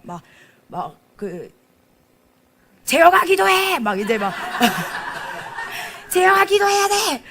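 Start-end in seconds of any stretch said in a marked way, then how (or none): 2.8-3.8 calling a name out loud and high; retold shout at kids. Pastor shouting at a student during worship. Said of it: "소리를 지르면서". 6.1-7.2 loud, high calls of each name; retold shouting. Pastor shouting names at students in worship. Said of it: "소리를 지르면서".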